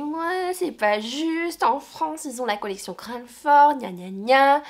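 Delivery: high, drawn-out mock whining; whiny complaint. Vlogger mimics her own nagging about a publisher, mock whining. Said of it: "je vous embête avec cette maison d'édition".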